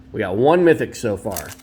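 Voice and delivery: ordinary delivery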